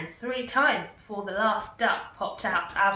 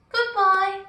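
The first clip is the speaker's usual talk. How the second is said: high, louder called goodbye; calling goodbye; 10 semitones higher, 6 dB louder than usual. A narrator's reading. Little girl calling goodbye to Gumboot, high call. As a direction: call after him kindly. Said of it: "called the little girl".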